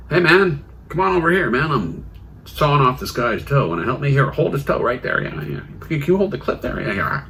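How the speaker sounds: mock offhand request for a helping hand; casual surgeon. Surgeon calling a cleaner over to help saw off a toe, lightly voiced and casual.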